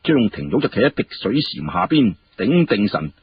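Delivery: plain reading voice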